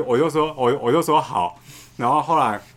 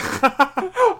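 high short laugh; 5 dB louder than usual. Real, not acted. Laughing at own joke.

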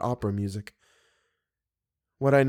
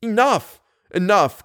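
sharp loud cry, higher; cried out; 7 semitones higher, 5 dB louder than usual. A narrator's reading. Raoul cries out to stop Christine's story, loud. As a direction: cut her off, loud and pained. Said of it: "cried Raoul".

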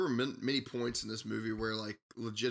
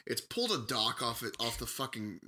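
normal talking voice

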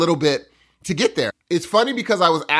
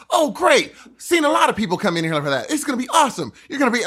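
higher, eager park ranger welcome; genuinely happy gate guy; 7 semitones higher, faster than usual. Retold or imitated. To a visitor at the park gate, gushing and high. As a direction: gush like a thrilled gate attendant greeting visitors. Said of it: "He was so genuinely happy".

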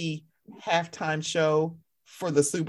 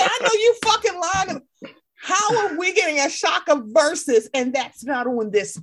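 retold outburst; 9 semitones higher, 4 dB louder than usual. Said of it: "I said".

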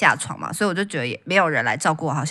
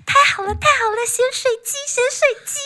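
much higher, gleeful imitation of a granny; delighted old lady. An old wife greeting the bought chicken, gleefully squealing. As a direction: squeal with glee over the chicken. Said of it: "买回来以后就说".

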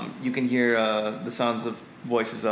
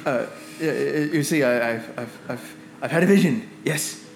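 put-on flustered, stammering old wizard with rising bursts; nervous wizard; 2 semitones higher than usual. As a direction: stammer out a warning, jumping up on key words. Plays frightened, muddled wizard Zephyros.

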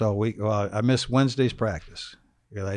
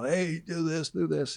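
mock yell; 7 semitones higher, 3 dB quieter, slower than usual. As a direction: imitate a coach calling out orders. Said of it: "Dick would yell".

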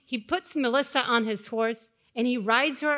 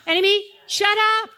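high, loud shout; bold rebuke; 7 semitones higher, 7 dB louder than usual. Shown as a demonstration. Pastor leading the church to rebuke, shouting. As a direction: shout it at the enemy. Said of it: "You wanna say it right now?".

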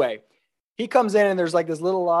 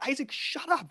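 employees hushing him; 10 semitones higher, 9 dB quieter than usual. Retold or imitated.

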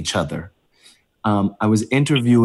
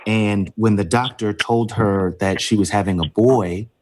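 usual speaking voice

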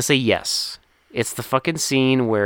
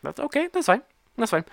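much higher, squeaky fake calm; mock reassurance; 11 semitones higher than usual. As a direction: pretend it's all fine, voice way up. Retelling own reaction to blanket.

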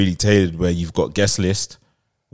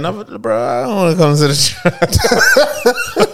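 whiny mock friend; 13 semitones higher than usual. Mocking friend's complaint, laughing.

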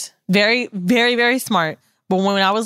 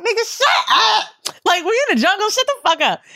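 high mock yell of shut up; mock shout; 12 semitones higher than usual. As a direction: shout him down, much higher. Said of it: "I'm like".

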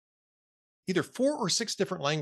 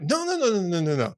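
offended protest; 4 semitones higher, 4 dB louder than usual. Retold or imitated. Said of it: "suddenly gets very offended".

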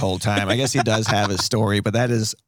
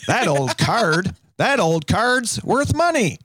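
much higher, slow hammed-up commercial read; ad pitchman; 9 semitones higher, slower than usual. Host inventing a baseball card commercial, slow hammed-up pitch. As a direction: sell it like a cheesy local ad. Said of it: "like the Victory Auto commercial".

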